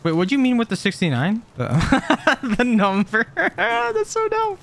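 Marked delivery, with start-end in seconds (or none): 1.6-4.6 words squeezed high through real laughter; cracking up. Real, not acted. Player tickled by the sixty-nine joke, cracking up. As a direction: laugh through it, pitch shooting up.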